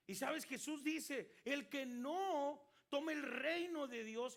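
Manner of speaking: fervent sermon delivery; emphatic preaching. Acted. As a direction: drive the verse home from the pulpit.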